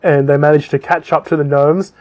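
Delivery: normal talking voice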